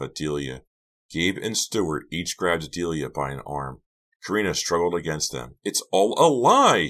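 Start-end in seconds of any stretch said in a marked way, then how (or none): 5.6-6.9 high, loud desperate wail. A narrator's reading. Karina struggles against the boys, wailing. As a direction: wail the words out. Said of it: "she wailed".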